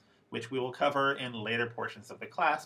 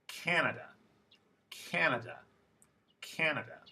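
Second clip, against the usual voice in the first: Canada with a longer first vowel, CAN-a-da; lengthened stressed vowel; 2 semitones higher than usual. Shown as a demonstration. Teacher modelling vowel length for students, stressed first syllable in Canada. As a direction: stretch the first syllable of Canada, then fall. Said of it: "These sounds should sound a little longer".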